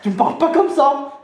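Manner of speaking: loud, high street-style protest; offended outburst. Acted. Offended thug candidate telling off his rival, loud and high. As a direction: flare up at the insult.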